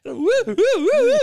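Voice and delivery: high, loud panicked whoops; string of mock-panic woos; freaked-out whooping. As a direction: act out frantic hopping yelps. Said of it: "it just freaked me out real fast".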